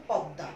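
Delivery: পদ্মা with the first vowel closed to an o; rounded first vowel. Shown as a demonstration. Teacher showing pupils the closed vowel, পদ্মা said with rounded o. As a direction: round the lips on পদ্মা, double the দ, silent ম. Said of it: "পদ্মা বলে আমি ঠোঁটকে সংবৃত করে ফেলছি".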